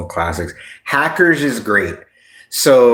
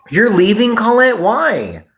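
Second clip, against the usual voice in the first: mock dramatic falsetto; 12 semitones higher than usual. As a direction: play it up as a hurt soap star. Feint drame envers Colette.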